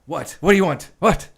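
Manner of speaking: sharp, loud, high angry snap. Retold or imitated. Director snapping at actors interrupting him, loud and angry. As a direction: bark it out like an irritated director. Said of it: "And he says, uh".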